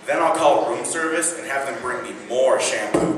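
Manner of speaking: usual speaking voice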